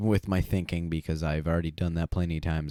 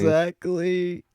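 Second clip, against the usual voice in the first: drawn-out high exclaim; 11 semitones higher, 4 dB louder, slower than usual. Real, not acted.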